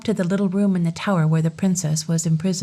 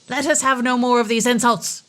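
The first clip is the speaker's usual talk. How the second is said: fairy's outcry; 5 semitones higher than usual. Acted. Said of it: "cried the fairy".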